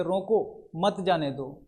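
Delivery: रोको set apart, then मत जाने दो as one phrase; slow, break right after रोको, before मत जाने दो; pause after रोको. Shown as a demonstration. Teacher shows that the words mean stop him, pausing after रोको. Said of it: "तो वो उसको रोक लेगा".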